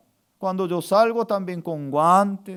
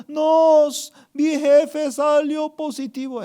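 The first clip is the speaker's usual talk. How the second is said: loud, high mock wail; imitated crying; 8 semitones higher, 5 dB louder than usual. Crying sister answers pastor's question, mock wailing. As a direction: blurt it through hammed-up tears. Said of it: "Ella estaba llorando".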